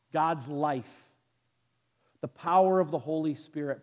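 ordinary talk

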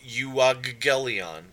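euangelion drawn out slowly as you-ah-ge-lee-on; slow Greek pronunciation. Shown as a demonstration. Teacher sounding out euangelion for the class, slowly. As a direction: spell out euangelion syllable by syllable. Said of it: "it's gonna look like aggelos or euang".

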